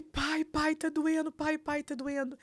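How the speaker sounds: high, urgent imitation of a distressed son; retold crying plea. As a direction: mimic a son crying out in pain. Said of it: "ele chorando muito". (imitando o filho aos prantos)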